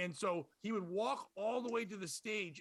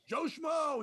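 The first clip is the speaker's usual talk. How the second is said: higher, louder host calling the name; mock yell; 8 semitones higher, 4 dB louder than usual. Host calling a comic's name to the crowd, loud and high. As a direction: call the name out like an announcer. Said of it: "He's, like, yelling it out".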